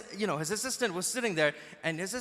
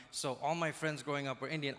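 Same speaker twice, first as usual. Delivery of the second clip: usual speaking voice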